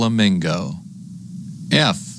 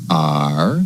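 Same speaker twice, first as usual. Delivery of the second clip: ordinary talk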